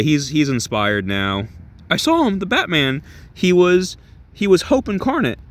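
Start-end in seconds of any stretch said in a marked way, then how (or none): 1.9-5.4 excited Edward. Retold or imitated. Edward voiced, excited about Batman.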